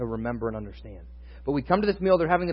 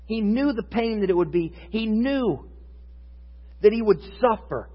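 raised, urgent sermon voice; impassioned preaching; 7 semitones higher than usual. Acted. Pastor stressing Jesus foreknew his suffering, impassioned preaching. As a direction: lift it and drive home the suffering.

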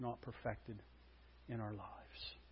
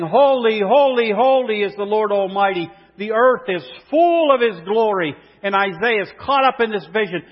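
loud, ringing pulpit voice; a loud, lifted preacher's cry; full-force preaching; 7 semitones higher, 15 dB louder than usual. Acted. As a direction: proclaim it to the whole room.

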